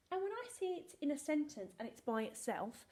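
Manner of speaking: ordinary talk, nothing marked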